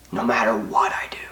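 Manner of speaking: a hushed, broken whisper trailing off; whispered despair. Real, not acted. Alone and unloved, he whispers the last words in despair. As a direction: let the words fall away into a whisper. Despair over being unworthy of love.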